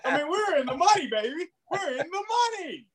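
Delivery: high, excited celebration. Real, not acted. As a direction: cheer it like a winner. Celebrating drill finished on time.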